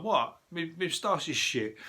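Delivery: high, mocking Brummy accent; lightly voiced Birmingham accent, indignant. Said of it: "That's a funny Brummy accent".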